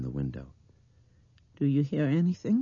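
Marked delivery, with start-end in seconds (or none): 1.6-2.6 high, loud child's mimicry; mimicking little girl. A narrator's reading. Blind girl repeating the men's words, high mimicry. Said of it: "she mimicked".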